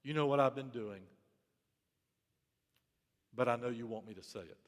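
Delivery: low, soft, humbled confession; confessing tone. Retold or imitated. Elijah confessing to God what he already knows, soft and low. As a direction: admit it quietly, head bowed. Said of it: "the tone this time is".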